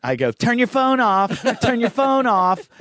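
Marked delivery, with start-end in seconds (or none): 0.3-1.4 high, slow announcement voice; mock attendant. Man teasing a flight attendant he meets in a bar, mock announcement. 1.6-2.7 high, slow announcement voice; mock attendant. Man teasing a flight attendant he meets in a bar, mock announcement.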